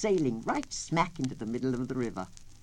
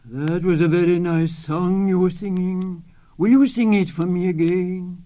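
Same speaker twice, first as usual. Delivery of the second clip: loud gruff coyote, louder than the narration; barking coyote voice; 3 semitones higher, 14 dB louder than usual. A narrator's reading. Coyote coaxing the turtle to sing, barking loudly. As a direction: bark it out like a sly wolf. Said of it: "said in his barking voice".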